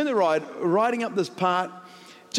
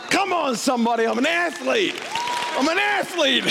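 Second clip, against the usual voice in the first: high and building to a crowing cry; rally the crowd round your own glory; mock triumphant boast; 9 semitones higher than usual. Real, not acted. Pastor bragging of smashing his record, mock triumph.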